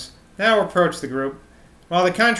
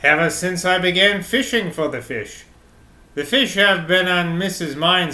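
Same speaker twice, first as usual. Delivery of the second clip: lightly voiced rustic London accent. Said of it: "reverting to his North London accent".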